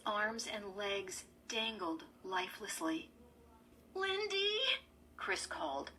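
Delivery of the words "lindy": high, fearful call; tight with fear. A narrator's reading. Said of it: "her throat tight with fear".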